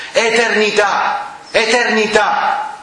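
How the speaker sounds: high, slow, drawn-out cry; imitating a shout. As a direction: cry out each word like a preacher in a hall. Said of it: "cominciò a urlare a Rimini".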